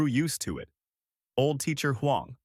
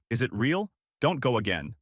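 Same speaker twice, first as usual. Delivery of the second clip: ordinary delivery, nothing marked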